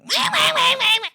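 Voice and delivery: put-on high, loud baby wail; piercing exaggerated crybaby imitation; mock whining. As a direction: mimic their whining at full volume. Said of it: "That's what you sound like to me".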